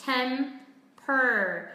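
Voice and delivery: Temper with a long, strong "er"; drawn-out American "-per" in Temper; heavy American R. Shown as a demonstration. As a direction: curl hard into the R of Temper. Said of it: "âm er rất là nặng".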